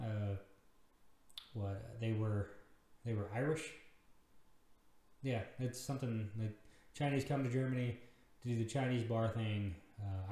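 ordinary talk, nothing marked

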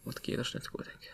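soft whispered thanks. Real, not acted. Thanking listeners at the very end, whispered. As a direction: whisper the thanks as the episode fades out. Softly signing off the podcast.

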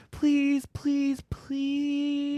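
high pleading whine; high begging whine. Retold or imitated. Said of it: "and then".

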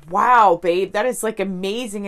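gushing with delight at the news; excited wow. Retold or imitated. Telling her husband about his job offer, an excited, loud wow.

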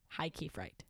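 hushed spooked whisper. Pretend. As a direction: breathe it out, spooked.

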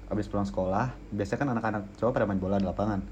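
ordinary talk, nothing marked

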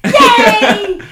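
loud high yay; excited cheer. Real, not acted.

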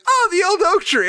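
a hammed-up impression, pitched far above the teller's own voice; imitating Kate. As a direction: chirp it out like an over-keen player in character. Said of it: "And she's like".

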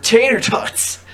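loud, drawn-out exclamation; mock outburst. Defending the chicken and waffles place to the co-host, loud mock outburst. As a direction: stretch it out and belt the end. Jokey outburst over tater tots.

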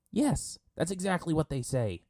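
high, loud exasperated retort; indignant high-pitched yell. A narrator's reading. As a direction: yell it back at them. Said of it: "Sokka yelled at them".

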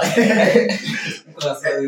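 short chuckling laugh mid-argument; real laugh. Real, not acted. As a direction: break off to laugh. Laughs amid own argument.

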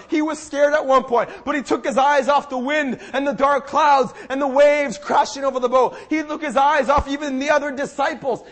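loud, driving sermon delivery; impassioned preaching. Real, not acted. Preacher urging the church to keep eyes on Jesus, impassioned. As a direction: preach it urgently, punching key words. Preaches the story at full force.

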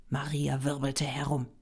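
usual speaking voice

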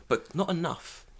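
normal talking voice

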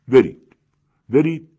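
two sharp, loud calls; urging call. A narrator's reading. Narrator to the villagers, urging them to go buy, loud call.

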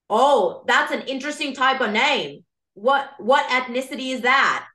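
put-on slightly slipping old Boston accent; John F. Kennedy. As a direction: pretend presidential curiosity in a Massachusetts accent. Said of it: "I'm losing the accent a little bit".

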